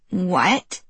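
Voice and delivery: whining, drawn-out what; whiny teenager. Acted. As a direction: complain like a sulky teen. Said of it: "he sounded like a whiny teenager".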